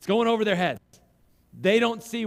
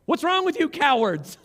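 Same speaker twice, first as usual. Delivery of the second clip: higher and louder accusing cry; indignant shout; 9 semitones higher, 6 dB louder than usual. Retold or imitated. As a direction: call them cowards at full voice. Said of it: "shows up and says".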